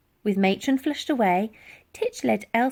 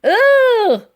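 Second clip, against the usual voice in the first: loud high disgusted ugh; disgusted cry; 14 semitones higher, 12 dB louder than usual. A narrator's reading. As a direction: recoil from the water spray. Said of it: "cried the Colonel".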